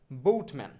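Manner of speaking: বোটম্যান with a gliding "bout" vowel, loud first beat; corrected pronunciation. Shown as a demonstration. Teacher drilling boatman with learners, বোটম্যান with "ou" glide. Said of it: "এইটা হচ্ছে এরকম হবে".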